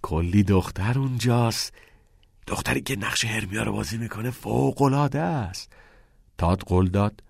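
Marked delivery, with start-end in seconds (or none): 0.0-5.7 mischievous. A narrator's reading. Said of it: "نیل با شیطنت گفت".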